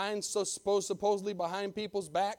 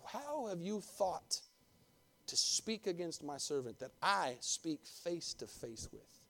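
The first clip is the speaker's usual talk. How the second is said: quiet stern rebuke; 2 semitones lower, 8 dB quieter, slower than usual. Acted. God reprimanding, quiet.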